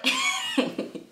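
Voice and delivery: high burst of laughter; giggling okay; real laugh. Real, not acted. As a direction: laugh at the idea. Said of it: "really fast?".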